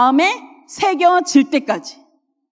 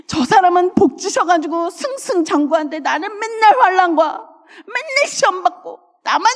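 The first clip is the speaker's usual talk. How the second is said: higher, whiny grumbling to God; higher whiny complaint; 5 semitones higher than usual. Retold or imitated. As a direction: play the sulky believer who feels unloved. Acting a self-pitying believer.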